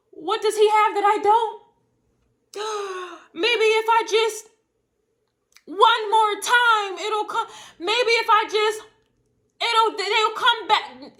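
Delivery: high hammed-up pleading with gasps and sobs; mock desperate ex. Mocking the jealous ex begging for the lover back, high and teary. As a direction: whine like a jilted ex begging.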